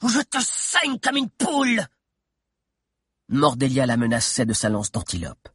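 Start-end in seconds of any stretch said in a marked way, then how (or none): 0.0-1.8 witch shouting. Acted. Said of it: "cria la sorcière à la cape noire".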